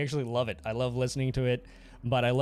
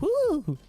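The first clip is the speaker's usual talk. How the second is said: mock high woo; 4 dB louder than usual. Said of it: "He's like".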